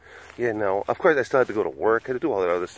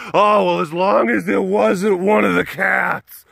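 mock viewer voice; 7 semitones higher than usual. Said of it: "collective sigh of relief".

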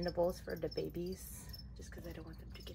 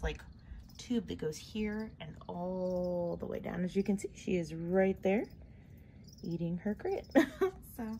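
ordinary talk, nothing marked

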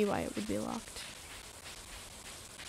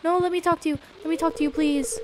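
higher, urgent pleading; 6 semitones higher, 4 dB louder than usual. Real, not acted. Player begging Maru for a chat, pleading. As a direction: beg for a moment of attention. Begging game character to talk.

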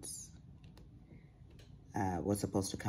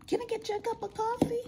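high, fast, sugary husband imitation; mock the husband's chirpy morning offer; mimicking husband; much faster than usual. Wife mocking her husband's cheery coffee offer, high and fast. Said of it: "he's literally like in the morning".

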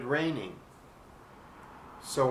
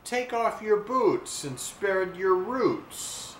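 tree's cruel joke; 6 semitones higher, slower than usual. A narrator's reading. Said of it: "one finally spoke".

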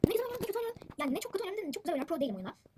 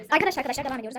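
loud call; 10 dB louder, faster than usual. Real, not acted. Calling viewers over background noise.

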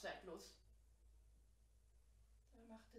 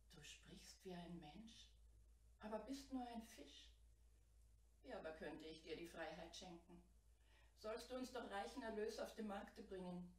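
ordinary delivery

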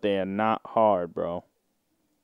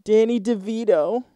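much higher, rising mock moan; 11 semitones higher than usual. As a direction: squeal the name like in bed. Moaning names read from sex thread.

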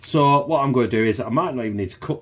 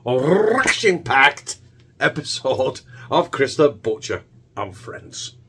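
put-on Scottish accent, half-hearted and slowed; Scottish accent attempt; 2 semitones lower than usual. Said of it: "me trying to do ration-packed in a Scottish accent".

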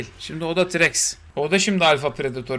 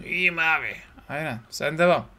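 ordinary talk

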